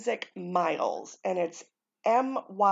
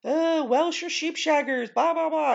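high-pitched mocking protest; mock indignant listener; 8 semitones higher, 3 dB louder than usual. Vlogger mimicking English or Welsh listeners' objections, mock indignant. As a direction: imitate an offended listener, pitched well up. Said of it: "anybody who's English or Welsh that's listening be like".

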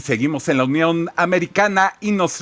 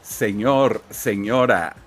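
much lower, deadpan radio host; low radio announcer; 9 semitones lower, 4 dB quieter than usual. Acted.

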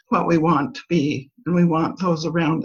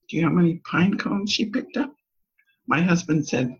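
usual speaking voice

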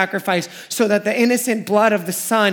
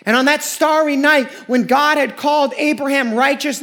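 ordinary talk, nothing marked

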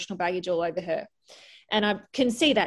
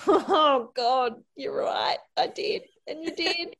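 laughing concession; 6 semitones higher, slower than usual. Real, not acted. Laughing admission.

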